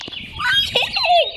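high burst of giggling; laugh. Real, not acted. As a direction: giggle out loud, delighted. Gleeful laugh at the chair.

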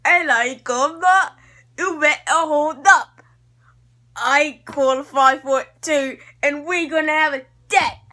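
brash high challenge; playful imitation of a bold wrestler; acting out Paige. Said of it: "And Paige is like".

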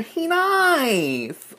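high, stretched exclamation; delighted squeal. Real, not acted. Delighted by air freshener.

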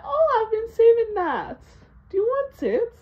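high, loud, eager imitation of dad; imitating dad. Dad sweetly offering his old soda. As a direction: put on a sing-song offering voice.

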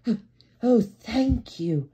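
high, delighted little engine; slow, loud, gushing high thanks; Percy. A narrator's reading. Percy thanks the fireman for the song, delighted. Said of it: "he said".

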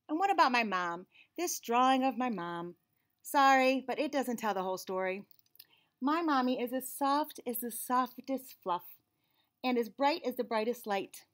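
put-on lively storybook reading in a child's narrating voice; picture book read-aloud. A narrator's reading. Reading a picture book to children, lively and bright. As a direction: read it like the kid telling the story.

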